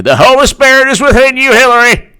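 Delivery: high, loud preacher blessing; mock preaching. Mock preacher blessing Hillary from the pulpit. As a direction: bless her like a revival preacher.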